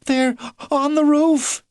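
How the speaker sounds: high, slow frightened line; alarmed. A narrator's reading. Missus Badger hearing tiles rattle, high and frightened. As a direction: hear them overhead and fear. Growlers heard on the roof.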